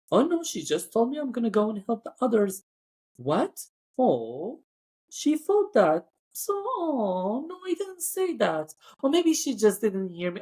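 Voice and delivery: high-pitched mock-innocent denial; feigned innocence. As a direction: play innocent, as if nothing happened. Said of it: "acting like nothing happened".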